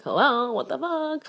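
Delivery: high, loud incredulous exclamation; indignant, high-pitched exasperation; exasperated outburst. Real, not acted. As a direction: cry out in disbelief at the obvious.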